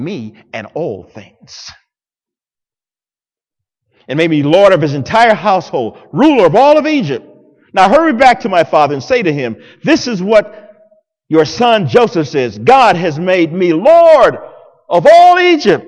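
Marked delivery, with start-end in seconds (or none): 4.1-15.9 louder and higher toward the climax; building preaching. Acted. Preacher voicing Joseph sending word to his father, loud climax. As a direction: drive it home like a sermon peak. Reading scripture with rising force.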